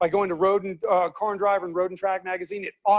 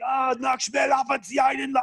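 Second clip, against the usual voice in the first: mock German bravado; 5 semitones higher than usual. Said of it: "so they're like".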